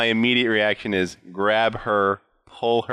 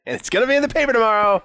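excited and fast; 12 semitones higher, 3 dB louder, much faster than usual. Acted. Excited kid reporter line.

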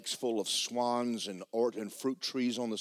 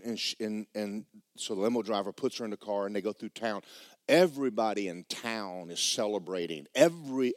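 ordinary talk

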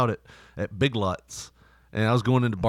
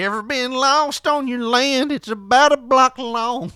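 higher, slow hammed-up country croon; mock country singer; 11 semitones higher, 5 dB louder, slower than usual. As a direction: ham up a cheesy country lyric. Mocking country song lines.

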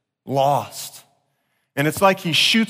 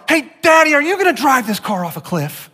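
put-on child's voice; 8 semitones higher than usual. Imagined kids asking worried question.

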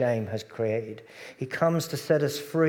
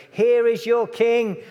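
higher, louder, slow announcement; Pilate proclaiming; 9 semitones higher, 7 dB louder, slower than usual. Acted. Pilate presenting Jesus to the crowd, loud and slow.

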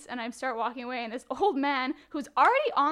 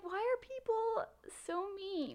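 mock whine; 8 semitones higher, 7 dB quieter, much slower than usual. Whiny retold complaint.

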